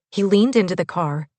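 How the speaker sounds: usual speaking voice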